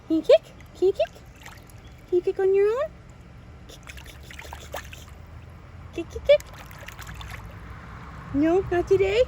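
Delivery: high, sing-song coaxing. Real, not acted. Instructor coaxing baby Sophie to kick alone, high baby talk.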